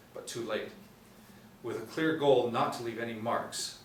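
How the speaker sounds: ordinary delivery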